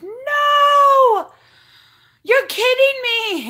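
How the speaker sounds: high, loud shriek of dismay, slowing on the second part; freaked-out disbelief. Retold or imitated. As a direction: cry out as if your favourite thing just broke. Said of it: "I was like".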